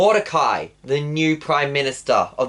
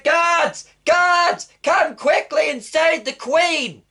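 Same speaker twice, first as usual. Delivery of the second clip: calling for guards; 11 semitones higher, much slower than usual. Acted. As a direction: bellow for help across the hall. King calls for the guards.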